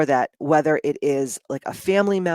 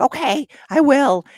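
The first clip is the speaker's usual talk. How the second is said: mom's voice, higher and louder, sweetly agreeing; high mock-innocent mom; 11 semitones higher, 6 dB louder than usual. Retelling her mom's eager promise to her daughter, high mock-innocent. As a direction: promise it brightly, like a daughter who won't keep it.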